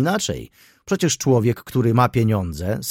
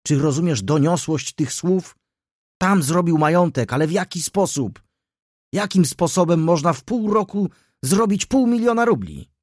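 higher and higher, building to an indignant peak; rising indignation; 8 semitones higher, 4 dB louder than usual. A narrator's reading. Councillor to Rzecki, building indignation about the fortune. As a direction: pitch climbs as the outrage grows. Councillor heatedly accusing Wokulski.

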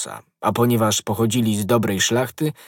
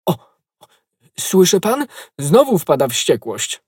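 louder, higher anxious exclamation; alarmed; 6 semitones higher, 4 dB louder than usual. A narrator's reading. Innkeeper warns guest of a rage, alarmed. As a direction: point out the uproar, raised and anxious.

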